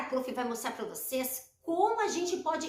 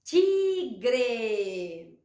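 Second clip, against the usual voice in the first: Tigre very slow, both syllables held; 5 semitones lower than usual. Shown as a demonstration. Teacher repeating the word for children, syllable by syllable. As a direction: stretch out ti, then gre in Tigre. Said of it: "ó".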